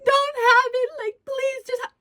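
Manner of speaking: high, loud, whiny pleading; mock begging. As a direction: parody a desperate girlfriend begging him. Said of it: "You don't see me over here like".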